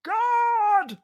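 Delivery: very high, loud cry; official's yell. Acted. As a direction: cry for the guard. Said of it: "the purple one yelled".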